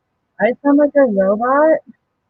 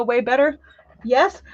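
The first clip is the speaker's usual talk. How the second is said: ordinary talk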